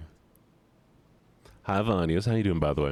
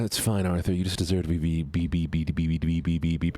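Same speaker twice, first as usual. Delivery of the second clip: ordinary talk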